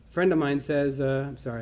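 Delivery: normal talking voice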